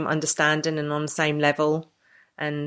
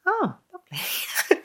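mock sweet reply; slower than usual. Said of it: "I was like".

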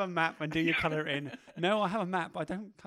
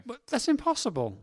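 indignant protest; 6 semitones higher, 6 dB louder than usual. Real, not acted. Protesting the quiz rules.

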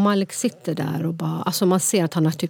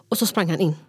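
normal talking voice

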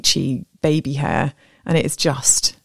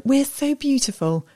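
put-on proud little girls; 8 semitones higher, slower than usual. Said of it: "they were like".

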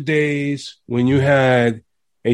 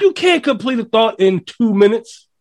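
passionate rant; 9 semitones higher than usual. Real, not acted. Ranting about modern songs.

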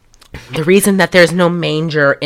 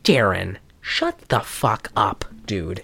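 low, soft, slow telling-off; exasperated aside; 11 semitones lower, 10 dB quieter, slower than usual. Retold or imitated. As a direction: drop the voice and draw it out wearily. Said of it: "And I'm like".

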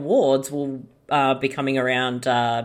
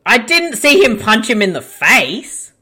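surprised; 7 semitones higher, 5 dB louder than usual. Real, not acted. Reacting to movie scene.

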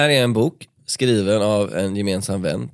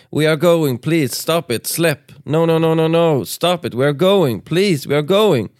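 higher, loud frantic pleading shout; screaming louder; 6 semitones higher, 5 dB louder, slower than usual. Acted. Sofia screaming at the men to let go, loud frantic plea. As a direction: scream it over the brawl to end it. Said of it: "Sofia skrek ännu högre".

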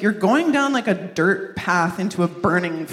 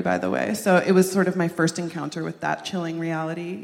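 normal talking voice

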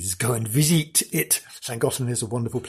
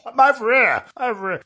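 high, louder put-on ghost voice; ghost answering; 10 semitones higher, 4 dB louder than usual. Ghost husband answering his wife from another room. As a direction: call back from the next room. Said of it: "he's in the other room going".

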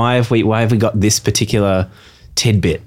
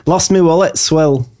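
slow northern English accent, a touch higher; 5 semitones higher than usual. Shown as a demonstration. Showing the panel the northern English accent. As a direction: drag out "Lost me wallet swill" in a broad northern English accent. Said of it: "like, a northern accent".

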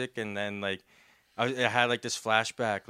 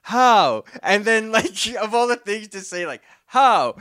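mock surprised; 8 semitones higher, 9 dB louder than usual. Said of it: "that guy was like".

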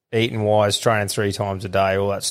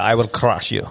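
put-on hard, heavy Russian accent; Russian tough guy. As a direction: growl it like a Russian strongman. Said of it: "the Russian stereotypical".

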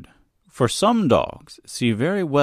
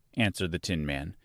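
usual speaking voice

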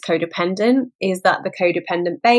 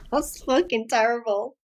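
laughing at herself; 7 semitones higher, 6 dB quieter than usual. Real, not acted.

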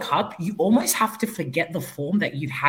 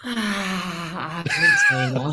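a squeaky, disbelieving Argentina; mock surprise; 3 semitones higher, much slower than usual. Real, not acted. Co-host reacts to Argentina's poor showing with a squeal of mock shock. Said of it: "Great reaction".

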